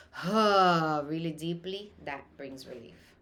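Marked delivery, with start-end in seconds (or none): 0.1-1.0 loud deep exhaled huh; deep birthing huh. Shown as a demonstration. Doula showing a laboring mom the breath, deep huh. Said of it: "bends over and says".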